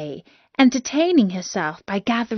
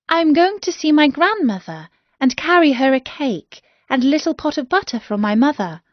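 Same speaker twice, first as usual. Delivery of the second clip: lightly voiced young girl, higher; a girl answering politely, higher pitched; a lightly voiced innocent girl, higher; 6 semitones higher, faster than usual.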